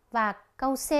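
ordinary delivery

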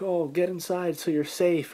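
a high, slow, urgent call to get inside; urgent husband. Retold or imitated. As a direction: hurry the family in like a worried husband. Husband hurries family indoors.

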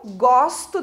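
GOSTO with an open O. Shown as a demonstration. Teacher giving an example sentence, open O in gosto. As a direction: say gosto slowly with the open vowel. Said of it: "com esse som aberto".

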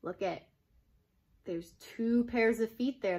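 usual speaking voice

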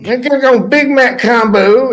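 goof around at the speaker box; drive-thru voice. Retold or imitated. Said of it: "doing little voices".

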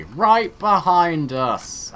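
sudden loud, drawn-out alarm; startled cry of alarm; alarmed shout. Real, not acted. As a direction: blurt it out as the enemy appears. Sudden alarm at enemy army.

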